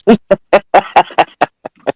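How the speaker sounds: long staccato chuckle; real amused laugh. Real, not acted. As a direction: laugh along at the story. Laughs at the retold scene.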